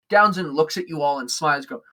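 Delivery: normal talking voice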